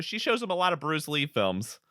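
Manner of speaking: normal talking voice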